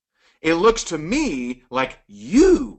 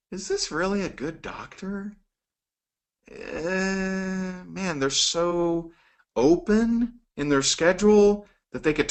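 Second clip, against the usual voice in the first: a hint of a softer, suspicious inner voice; mild doubtful thinking; 4 semitones higher, 3 dB quieter than usual. Patient doubting an empty doctor's schedule, lightly voiced. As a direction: muse half-aloud, unsure of the doctor. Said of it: "Might you wonder".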